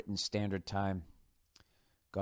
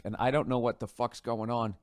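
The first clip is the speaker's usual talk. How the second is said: ordinary talk, nothing marked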